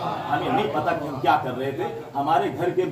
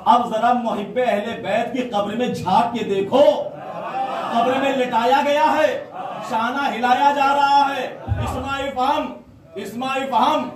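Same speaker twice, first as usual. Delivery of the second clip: high, loud, rising sermon climax; impassioned majlis preaching; 9 semitones higher, 4 dB louder, slower than usual. Acted. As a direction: preach it out to the crowd with fervour. Majlis preaching at full force. (پرجوش مجلس کا خطاب)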